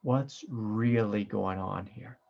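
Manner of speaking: ordinary talk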